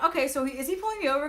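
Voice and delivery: rising high bewildered question; puzzled and alarmed; confused surprise. Retold or imitated. Confused as cop lights up.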